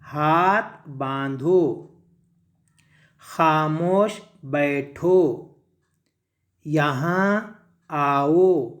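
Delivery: very slow, drawn-out words with long pauses; stretched syllables, word by word; model reading. Shown as a demonstration. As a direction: read each phrase slowly for learners to follow. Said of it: "इन जुमलों को ज़रा सा पढ़ो एक साथ".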